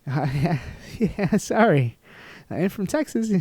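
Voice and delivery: high-pitched, goofy mock apology; sheepish dumb apology. Nervous Texan apologizing to the official, mock-sheepish. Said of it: "that's what I'm coming off as".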